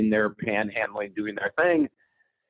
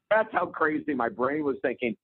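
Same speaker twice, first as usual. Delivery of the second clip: ordinary talk, nothing marked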